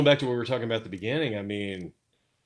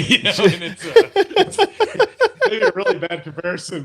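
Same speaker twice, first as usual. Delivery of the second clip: words bubbling through real laughter, pitched up high; laughing; 10 semitones higher, 6 dB louder than usual. Real, not acted. Guest laughing at his own silly McRib comparison, giggly. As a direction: giggle through it, amused at yourself.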